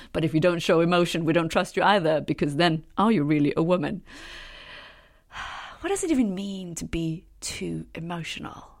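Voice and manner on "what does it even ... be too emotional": a soft, breathy whispered question after a sigh. Real, not acted. To viewers, musing on being called emotional, a sighed whisper. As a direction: sigh and ask it under your breath.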